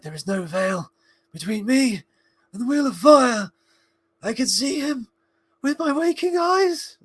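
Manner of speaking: high, mounting mock panic; Frodo freaking out. Imitating Frodo at his breaking point, high hammed-up panic. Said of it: "like, freaking out".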